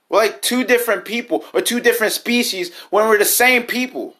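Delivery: high, loud, exasperated rant; frustrated outburst. Real, not acted. Heated rant about racism.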